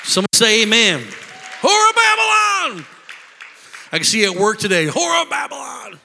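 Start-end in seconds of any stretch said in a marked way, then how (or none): 1.6-2.9 high, shouted condemnation; shouted denunciation. Acted. Preacher reenacts the shout. 4.9-6.0 shouted again, less high; shouted denunciation. Acted. Preacher repeats the shout.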